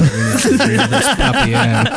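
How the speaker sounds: laughing through the words, pitch jumping up. Real, not acted. Podcast host laughing at the geeky wine tangent. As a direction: giggle while pleading to get back on track. Laughing while urging to refocus.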